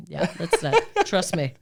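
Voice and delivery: ordinary talk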